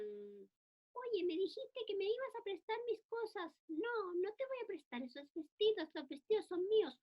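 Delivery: put-on high child's voice, whiny squabble; squabbling child. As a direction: play a little girl fighting over dresses. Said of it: "Los niños juegan". (niña peleando por los vestidos, voz aguda)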